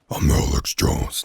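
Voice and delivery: failed impression in an unvoiced rasp; badly faked rasping celebrity voice; Alex Jones. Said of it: "I don't know how to do Alex Jones".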